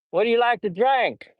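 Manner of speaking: high, twangy Southern question; Southern accent. Acted. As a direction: play the Southern host, pitched well up. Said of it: "too much of a Southern accent".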